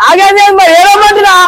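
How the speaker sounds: high, exasperated insistence; exasperated demand. Acted. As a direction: push the demand with pitch right up. Pestering them to cry.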